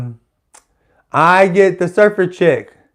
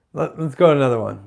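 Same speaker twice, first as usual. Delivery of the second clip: ordinary talk, nothing marked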